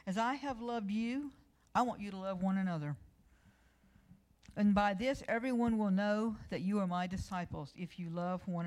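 loud, solemn preaching of scripture; booming pulpit reading of the Bible. Acted. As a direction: proclaim the verses like a preacher. Reads scripture at preaching force.

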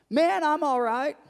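high, loud easygoing reply; cheery stranger. Retold or imitated. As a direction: play the happy guy answering back. Stranger answers the pastor.

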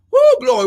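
sharp high whoop into glory. Real, not acted. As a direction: fling out a joyful whoop. Praise eruption.

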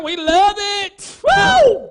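loud, high happy whoop; excited whoop. Retold or imitated. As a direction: whoop like a greedy goat. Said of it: "That's my goat impression for you today".

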